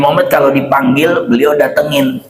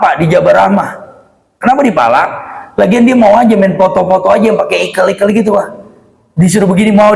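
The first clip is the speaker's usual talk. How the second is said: usual speaking voice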